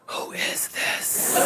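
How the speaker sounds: slow, soft whisper; soft whispered suspicion; suspicious whisper. Retold or imitated. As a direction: hiss it under your breath. Marie suspicious on call.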